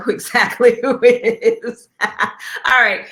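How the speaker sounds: can't hold back a knowing laugh. Real, not acted. Host laughs recognizing the anchorman.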